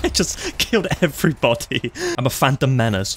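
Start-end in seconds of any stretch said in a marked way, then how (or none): 0.0-1.9 higher, giggly boast; amused laughing. Real, not acted. Gamer telling friend of the kills, laughing. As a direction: laugh breaking into the words. Laughs after wiping out the enemy.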